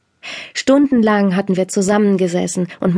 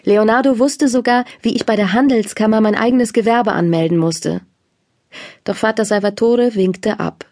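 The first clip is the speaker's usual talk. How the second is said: ordinary delivery, nothing marked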